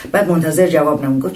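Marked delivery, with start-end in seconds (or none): none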